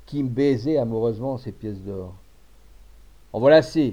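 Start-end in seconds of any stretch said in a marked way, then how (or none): 3.3-3.9 loud, abrupt command to stop; firm interruption. A narrator's reading. Jean-François stepping in to take the blame, firm. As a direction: cut them off forcefully. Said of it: "fit-il d'une voix sourde".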